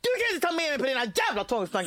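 exasperated outburst. Retold or imitated. Partner arguing over obsessive rituals, exasperated. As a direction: snap it out fast in a mock row. Re-enacted quarrel with partner before show.

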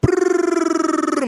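high trilled propeller buzz; aeroplane noise. Acted. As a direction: buzz like a toy plane feeding a baby. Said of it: "machte meine Tante".

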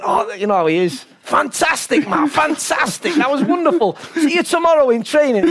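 high, loud, gushing praise; gushing Per. Retold or imitated. Per gushing to Mark after the film, high and loud. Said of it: "he went".

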